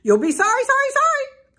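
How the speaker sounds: loud, very high goose squawk; calling goose. A narrator's reading. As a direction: call out as a goose, climbing to a shrill high pitch. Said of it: "called the goose".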